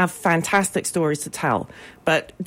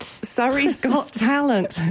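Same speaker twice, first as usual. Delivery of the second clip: slower, higher, with a laugh breaking in; amused repeat; 7 semitones higher, slower than usual. Real, not acted. Guest repeats the talent show's name to disbelieving host, amused. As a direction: repeat it slowly through a chuckle.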